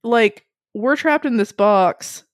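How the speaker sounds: very slow, drawn-out thinking aloud; pondering aloud as she quotes a friend's odd logic; slow musing. Retold or imitated. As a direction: puzzle it out word by word. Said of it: "Peter's like".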